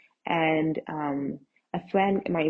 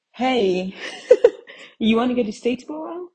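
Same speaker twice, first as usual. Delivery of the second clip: higher, louder, laughing wheedle; coaxing request; 5 semitones higher, 6 dB louder than usual. Retold or imitated. Texting a friend for a ride, playfully coaxing. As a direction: pitch the favour with a giggle.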